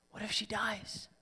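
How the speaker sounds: whispered dread of a father; a frightened father whispering his fear. Retold or imitated. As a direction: breathe the fear out, barely voiced. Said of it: "imagine what's going through his mind".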